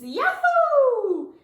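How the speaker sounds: high, loud joyful whoop; yelled. A narrator's reading. Teacher jumping down the tube, loud high whoop. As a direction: whoop as you jump. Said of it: "she yelled".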